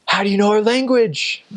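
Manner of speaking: high-pitched imitated astonishment; amazed. Locals amazed he speaks their language.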